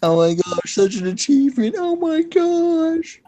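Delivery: hammed-up high-pitched awe; mock amazement. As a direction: gush with drawn-out, climbing pitch. Mock awe at being famous.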